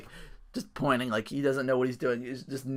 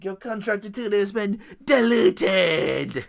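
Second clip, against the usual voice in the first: higher, slow theatrical decree; mock grand announcement; 7 semitones higher, 6 dB louder, slower than usual. Mimicking Matt Hardy firing the contractor, slow theatrical decree. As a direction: announce it like a court herald.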